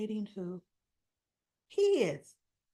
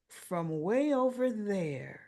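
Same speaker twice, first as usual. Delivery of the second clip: sarcastic aside; much slower than usual. Retold or imitated. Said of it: "that's what Christina's like".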